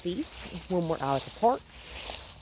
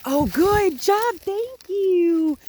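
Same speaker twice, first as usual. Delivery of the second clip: much higher, louder yays; excited cheering; 14 semitones higher, 8 dB louder, much slower than usual. Real, not acted. Trainer cheering the puppy on with yays. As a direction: cheer like she won a prize.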